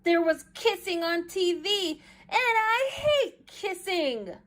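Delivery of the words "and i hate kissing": disgusted whine. A narrator's reading. Child disgusted.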